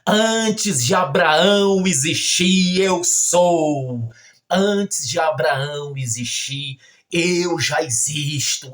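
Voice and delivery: slow, loud declamation; ringing pulpit proclamation; Jesus. Retold or imitated. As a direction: proclaim it with drawn-out, weighty words. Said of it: "Jesus dizendo".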